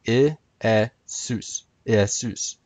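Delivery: Iesus sounded out ih, eh, SOOS, then joined; classical Greek pronunciation. Shown as a demonstration. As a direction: break Iesus into syllables, accent last. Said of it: "with the accent on Sus".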